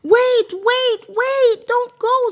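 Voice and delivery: very high, loud frantic calls; frog calling. A narrator's reading. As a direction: shout after her as she runs off. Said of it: "said the frog".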